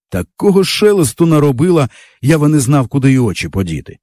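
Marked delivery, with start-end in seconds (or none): none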